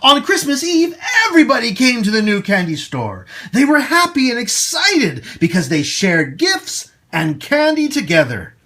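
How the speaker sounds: plain reading voice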